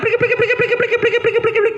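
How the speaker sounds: high squeaky prik prik; dragonfly sound. Shown as a demonstration. Ustadz imitates a dragonfly for the children, high squeaky chirps. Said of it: "gitu kan ya".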